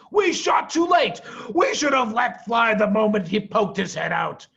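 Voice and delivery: angry farmer. A narrator's reading. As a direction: blame the timing loudly. Boggis angry at missing.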